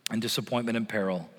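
normal talking voice

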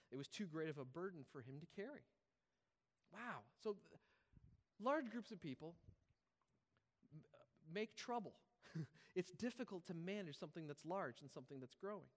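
usual speaking voice

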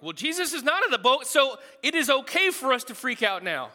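mock reasoning. Disciples talking themselves into panic, hammed-up. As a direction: sell the silly logic brightly. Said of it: "they're like".

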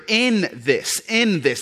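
"in" held and leaned on, "in this"; stress on IN. Real, not acted. Preacher showing the congregation the key word, stress on "in". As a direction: land firmly on the word "in" in "in this". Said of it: "and that's the key word".

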